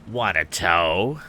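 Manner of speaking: slow, mocking sneer; the bully taunts Tom from his boat. A narrator's reading. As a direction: drag it out with contempt. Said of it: "sneered Andy".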